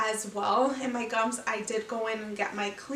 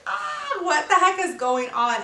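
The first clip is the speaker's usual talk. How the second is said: sudden high yelp of alarm, then faster; startled cry; 6 semitones higher, 5 dB louder than usual. Retold or imitated. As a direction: jump at the sight of blood.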